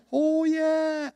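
high, dreamy exclamation; light up at a memory in a high voice; pleased recognition. Acted. Samson recalls the grapes.

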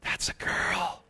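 hushed whisper, slow; whispered correction. Retold or imitated. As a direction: whisper it slowly like a warning. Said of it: "they're like".

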